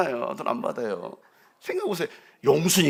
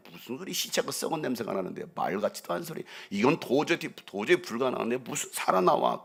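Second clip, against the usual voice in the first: scoffing onlookers; 4 semitones lower, 6 dB quieter than usual. Retold or imitated. Mocking onlookers quoted.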